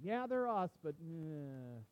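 trailing into a low, drawn-out "eh"; grudgingly admit, then wave away; dismissive. Acted. Judeans speaking of Galileans, mildly dismissive. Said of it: "that's how they felt about the Galileans".

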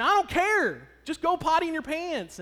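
retold hollering, pushing past the objection; frustrated holler. Dad hollering at wife to let son pee, frustrated. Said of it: "and I'm hollering".

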